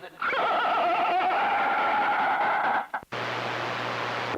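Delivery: cackling laugh. Real, not acted. Laugh before taunt.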